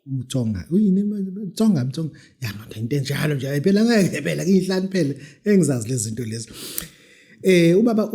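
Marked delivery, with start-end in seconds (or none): none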